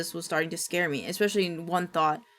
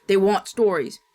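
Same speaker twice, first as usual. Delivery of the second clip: snarled; 7 dB louder than usual. Acted. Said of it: "he snarled".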